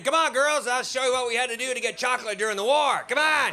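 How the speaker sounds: high, loud Geordie granny impression; rally the girls like a game old granny; hammed-up high-pitched gran, calling out; rallying grandmother. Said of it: "You see 'em leading the way".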